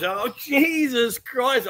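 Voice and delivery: retold shock, high and drawn out; startled exclamation. Streamer re-enacting his reaction to the explosion, startled. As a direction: re-enact the startled yelp, stretching the words.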